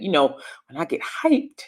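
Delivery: ordinary delivery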